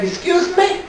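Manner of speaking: high, questioning comeback; mock indignant. Retelling a kitchen row, mock indignant. Offended reply in a retold quarrel.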